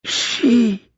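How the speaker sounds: high, loud cry forced through pain; pained gasped shout. Acted. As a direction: force the word out against agony. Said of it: "gasp out the next word".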